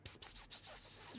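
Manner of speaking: breathy psst-psst whisper. Pretend. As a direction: hiss a secret psst to get her attention. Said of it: "Tell her".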